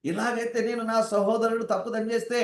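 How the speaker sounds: high-pitched, incredulous plea; astonished Peter. Acted. Disciple asking the Lord about forgiving a brother, astonished. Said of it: "పేతురు గారు ఆశ్చర్యపోతూ అడుగుతాడు కదా".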